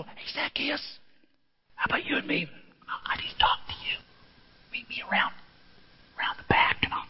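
secretive whisper. Pretend. Pastor mocks a sneaky Jesus calling Zacchaeus aside, whispered. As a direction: hush it like a back-alley invite. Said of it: "he says it loud enough for everybody".

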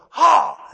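loud, high mock "ha"; explosive imitated class kiai; martial arts yell.

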